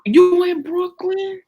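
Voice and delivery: high, drawn-out mock New York accent; exaggerated singsong New York question. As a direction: stretch it out high as a put-on New Yorker. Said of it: "I'm talking like I'm from New York".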